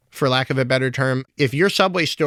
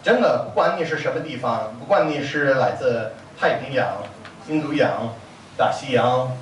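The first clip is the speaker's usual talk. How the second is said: rapid-fire Mandarin list, rising higher and higher; Beijing-accent tongue twister; 5 semitones higher than usual. Shown as a demonstration. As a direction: rattle off countries like a crosstalk comedian, faster and higher. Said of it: "rapid-fire tongue twister with the Beijing accent".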